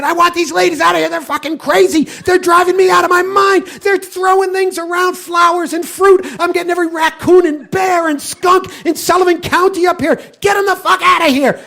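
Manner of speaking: high-pitched furious shouting rant; furious groundskeeper. Retold or imitated. Groundskeeper yelling at the owner in a high, furious shout. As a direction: scream at him like a man at his wits' end.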